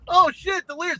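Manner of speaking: sudden starstruck exclamation; excited recognition. Retold or imitated. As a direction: blurt it out like a starstruck fan. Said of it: "and go".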